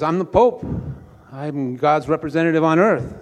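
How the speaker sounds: loud, high, mock-indignant Pope; indignant protest. Pope turned away by Saint Peter at the gates, indignant protest. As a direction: announce your rank in comic outrage. Rebuffed Pope asserts his rank.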